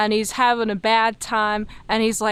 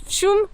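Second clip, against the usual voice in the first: whoosh sound effect; 9 semitones higher than usual. Pretend. Sound effect of ship diving.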